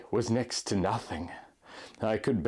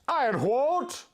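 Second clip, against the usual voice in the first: curious; 12 semitones higher, slower than usual. A narrator's reading. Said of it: "I asked with curiosity in my voice".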